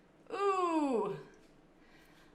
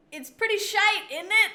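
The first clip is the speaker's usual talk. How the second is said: imitated Birmingham accent; 9 semitones higher, 7 dB louder than usual. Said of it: "everybody from Birmingham".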